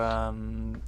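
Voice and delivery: normal talking voice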